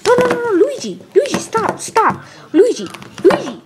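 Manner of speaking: high, urgent protest; alarmed pleading. Acted. Mario begging Luigi to stop, alarmed. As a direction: try to stop him, voice shooting up.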